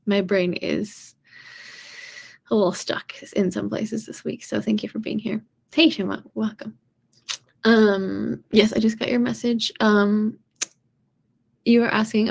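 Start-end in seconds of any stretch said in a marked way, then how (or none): none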